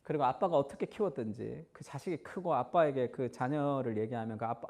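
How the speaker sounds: ordinary delivery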